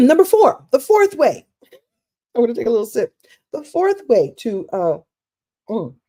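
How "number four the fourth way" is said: big announcement. Real, not acted. Announcing the next point.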